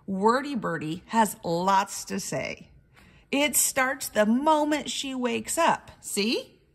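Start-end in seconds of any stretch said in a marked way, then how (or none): none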